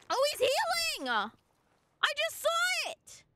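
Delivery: high, startled cry of disbelief, the second line stretched slow; shocked. Real, not acted. Player sees the dragon heal, shocked high cry. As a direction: yelp it as the boss's health bar climbs back. Boss heals mid fight.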